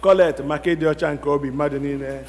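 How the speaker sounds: ordinary talk, nothing marked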